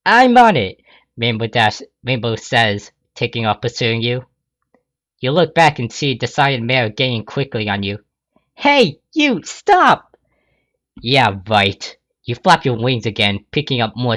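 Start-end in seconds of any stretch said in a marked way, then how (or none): 0.0-0.8 high, loud, eager; eager Rainbow. Acted. Rainbow Dash eager reply. 8.5-10.2 high loud call to stop. Acted. Pursuer calls out to thief.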